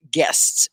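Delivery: GUESTS said loud, full "sts" ending; one syllable. Shown as a demonstration. Teacher confirms the word on screen, one syllable. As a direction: hit GUESTS as a single beat. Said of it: "right, one syllable".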